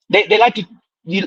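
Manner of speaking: ordinary delivery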